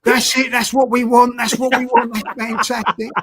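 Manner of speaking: loud, high "Cut, cut" shouted across the set; shouting cut over the set, high and loud; excited director. Retold or imitated. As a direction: call cut like a thrilled director. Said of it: "he goes over the old director".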